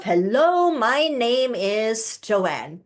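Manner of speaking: slow, steady "my name is Joanne"; grounded introduction. Shown as a demonstration. Coach modeling a calm self introduction. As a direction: introduce yourself unhurried and grounded. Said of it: "let's just introduce yourself".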